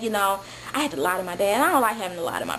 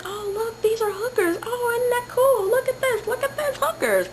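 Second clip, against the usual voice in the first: much higher giddy gawking; naive amazed girl; 12 semitones higher than usual. Retold or imitated. Sheltered girl passing hookers, gawking in a high voice. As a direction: sound thrilled like a sheltered tourist. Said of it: "I'm like".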